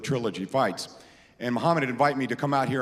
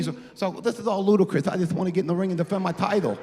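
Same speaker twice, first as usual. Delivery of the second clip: higher, put-on voice of the heavyweight champ; Mike Tyson impression; 5 semitones higher than usual. Telling the crowd, higher bad Tyson impression. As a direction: play Tyson, eager to defend the title. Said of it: "Do an impression of me".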